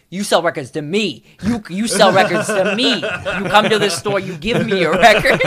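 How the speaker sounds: a hammed-up scold through laughter; mock scolding. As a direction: play the shop owner telling him off, half laughing. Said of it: "And he's like".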